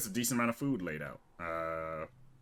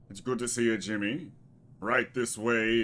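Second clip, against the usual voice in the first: welcoming cultist; 4 semitones higher, 6 dB louder than usual. Acted. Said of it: "this cultist here goes".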